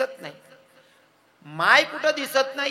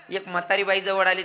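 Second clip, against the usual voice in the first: ordinary talk, nothing marked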